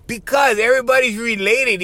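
ordinary delivery

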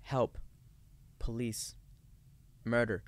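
half-hearted cry for help, one word at a time; deadpan mock cries. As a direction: imitate a listless bystander, pausing between words.

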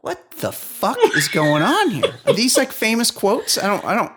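laughing outrage at the quote; incredulous. Real, not acted. Baffled at the readings.

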